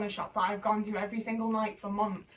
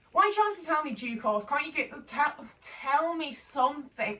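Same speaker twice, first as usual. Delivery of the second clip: higher-pitched pleading frustration; pitched-up, fed-up plea; exasperated; 5 semitones higher than usual. Real, not acted. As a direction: demand an answer, exasperated. Exasperated plea at a vague answer.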